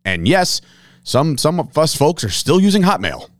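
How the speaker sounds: loud, upbeat sponsor read. Acted. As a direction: pitch it like a radio ad. Reads sponsor ads.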